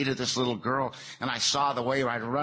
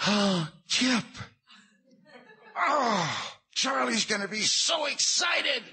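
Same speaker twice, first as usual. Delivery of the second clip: much higher, excited squeal; Edie overjoyed; slower than usual. Retold or imitated. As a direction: gush with delight at the door. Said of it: "and she goes".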